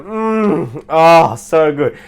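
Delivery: a hammed-up yummy moan; playful moaning at dinner; mock food moaning. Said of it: "we started moaning".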